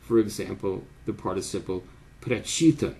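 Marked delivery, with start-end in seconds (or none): 2.2-2.9 "прочитан" stressed on "чи", the next-to-last syllable; next-to-last stem stress. Shown as a demonstration. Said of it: "stresses the next to last stem syllable".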